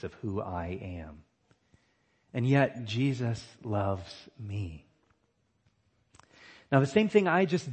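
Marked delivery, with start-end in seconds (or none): 2.8-4.8 slow and lower, each word apart, landing on "me"; stress on me. Shown as a demonstration. Preacher concluding his point on unworthiness, slow stress on "me". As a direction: spell it out slowly, settling on "me". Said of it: "emphasizing a different word each time".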